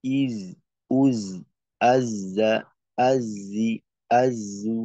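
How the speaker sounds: crisp Quranic syllables ইজ উজ আজ্জা; letter sounds demo. Shown as a demonstration. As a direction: sound out ইজ, উজ, আজ্জা, আজ্জি, আজ্জু one by one. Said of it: "এরকম।". (ধীরে ধীরে হরফের উচ্চারণ)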